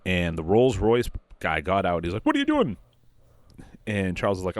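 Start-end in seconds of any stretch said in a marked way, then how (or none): 2.2-2.8 high yelled what are you doing; squeaky outraged yelp at the crash; mock angry yell. Rolls Royce guy yelling at Charles after the crash, high outraged yelp.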